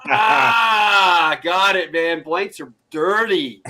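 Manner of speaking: loud mock shout of realization, then slow; mock gotcha shout. Recruit realizing the dirty trick, mock shout. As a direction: call out the trick, half laughing. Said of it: "I'm like".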